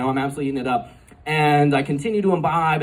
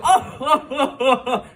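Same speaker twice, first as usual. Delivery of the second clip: big jolly ho-ho laugh, much higher; Santa laugh; 11 semitones higher than usual. Retold or imitated. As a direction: boom out a hearty Santa chuckle. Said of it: "big kind of Santa Claus guy".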